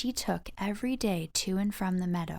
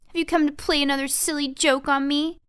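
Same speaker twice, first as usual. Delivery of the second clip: louder, higher, fast snapping question; a light, quick accusation; suspicious grouch; 6 semitones higher, 6 dB louder, faster than usual. Acted. Tweez suspecting the mice at her door, quick and cross.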